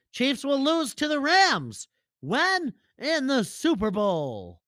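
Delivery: high, slow put-on prediction; Lenny voice. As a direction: deliver the call like a smug know-it-all. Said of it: "Lenny answers the host".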